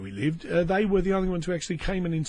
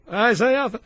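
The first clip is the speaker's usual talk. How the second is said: louder, higher call for attention; insistent call; 7 semitones higher, 5 dB louder than usual. Pretend. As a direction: raise your voice to make Arthur hear. Last, insistent call to Arthur.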